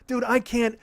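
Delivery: ordinary delivery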